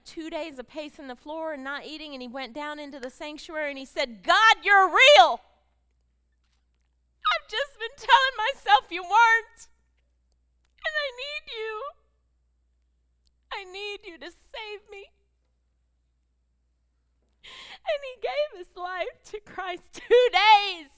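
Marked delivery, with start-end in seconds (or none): 4.2-15.1 crying out to God. Retold or imitated. Son's breakthrough prayer retold. 17.4-20.9 high, loud, slow preaching climax; full-force preaching. Acted.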